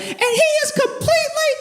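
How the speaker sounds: very high mock screech; imitated screaming. Lady defending the mayor over protesters, mock screaming. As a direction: keep screeching at the crowd, hammed up. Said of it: "she's just screaming, screaming, right?".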